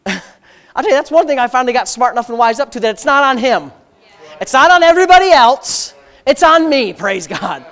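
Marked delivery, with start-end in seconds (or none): 4.4-6.9 louder, higher preaching; preaching at full force. Acted. Preacher testifying to the congregation, louder and higher. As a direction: insist on responsibility before the church.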